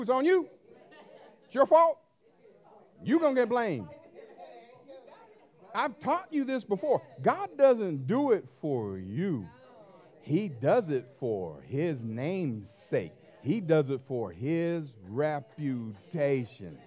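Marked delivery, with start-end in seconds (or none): 0.0-3.9 loud, rising declaration; fervent preacher shout; preaching full force. Real, not acted. Preaching at God. 7.2-16.8 very slow, weighty delivery; solemn slow preaching; slow emphasis. Real, not acted.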